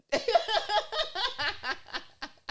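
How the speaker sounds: long, loud, high-pitched laughing fit. Real, not acted. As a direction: burst into a real high laugh. Bursts out laughing mid-talk.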